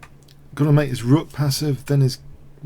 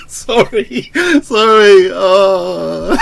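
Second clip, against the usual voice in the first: laughing apology; 7 semitones higher, 10 dB louder, much slower than usual. Real, not acted. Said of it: "I'm laughing".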